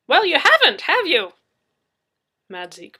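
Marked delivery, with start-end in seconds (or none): 0.1-1.3 high, loud needling retort; Madzeke's voice. Acted. Madzeke presses Murphy, high and loud.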